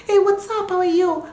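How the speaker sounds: light, high-pitched friendly hello; chatty, unstrained high greeting; talking voice. Shown as a demonstration. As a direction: greet them in a high, easy voice. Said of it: "So when I'm talking".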